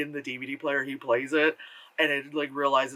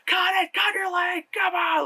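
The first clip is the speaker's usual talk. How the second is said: mock screaming. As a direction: scream at her to hurry up. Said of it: "like, screaming".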